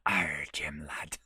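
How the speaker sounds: a half-hearted stab at a pirate; bad pirate voice. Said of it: "I am terrible at that".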